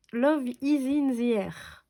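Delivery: Love is in the air said slowly, each word set apart; slow careful English. Real, not acted. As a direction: spell out the English title slowly. Repeats English collection title.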